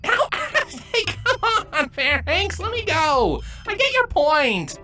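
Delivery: high, laughing whine; escalating whine. Acted. Begging his broodmate to let go, whining and laughing. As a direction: plead to be released through giggles. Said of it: "and the whining escalates".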